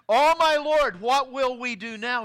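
high, loud desperate cry of the servant; frightened servant crying out to Elisha; panicked cry. Retold or imitated. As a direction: cry out in alarm to the prophet. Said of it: "he cried out to Elisha".

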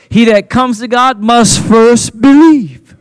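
loud, high, slow verse delivery; forceful, measured sermon shout; emphatic preaching. Real, not acted. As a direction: hammer each word out slowly to the congregation.

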